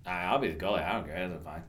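low, soft, dismissive retreat; sheepish mumble. Retold or imitated. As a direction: shrug it off under your breath. Acting out his sheepish younger self.

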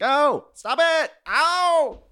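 high, slow squealing protest; mock pained squeal. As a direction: squeal like being nibbled. Man voiced while friends bite leg.

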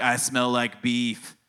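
put-on low, soft, drawn-out little-girl quote; low, slow mock child voice; imitated little girl. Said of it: "where the little girl's like".

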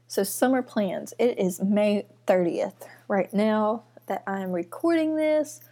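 ordinary talk

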